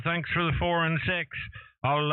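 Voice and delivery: normal talking voice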